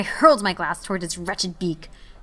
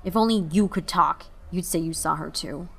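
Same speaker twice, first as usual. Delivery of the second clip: plain reading voice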